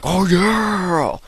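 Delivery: high, drawn-out catchphrase yell; Kool-Aid Man. Retold or imitated. Gamer mimicking his newly evolved Pokemon, big catchphrase yell. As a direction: burst out with a stretched, hammed-up cheer. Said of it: "He's like the Kool-Aid man".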